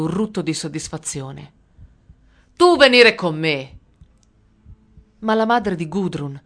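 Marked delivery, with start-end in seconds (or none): 2.5-3.8 commanding soldier. A narrator's reading. Soldier orders girl to come.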